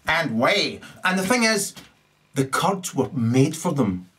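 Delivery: ordinary talk, nothing marked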